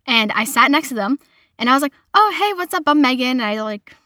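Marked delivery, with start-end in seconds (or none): 2.1-3.4 high, friendly retold greeting; imitating an over-friendly hello; trying to be nice. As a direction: introduce yourself eagerly, voice pitched up. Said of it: "trying to be nice and everything".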